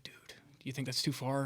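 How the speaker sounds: hushed unvoiced whisper, barely audible; hunting whisper. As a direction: whisper so the turkeys can't hear. Whispering to partner during hunt.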